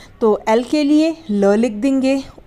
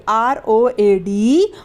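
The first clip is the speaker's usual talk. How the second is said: slow spelling; much slower than usual. Shown as a demonstration. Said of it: "spelling out a word".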